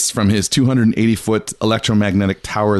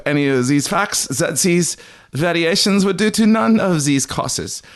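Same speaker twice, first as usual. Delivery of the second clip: a higher, wandering half-Scottish lilt for Tesla's words; vaguely Scottish accent; 7 semitones higher than usual. Acted. Host reading Tesla's words to cohosts, in a vaguely Scottish accent. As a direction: read the quote in a shifting mock Scottish brogue. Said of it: "a vaguely Scottish rotating accent that's louder than my normal voice".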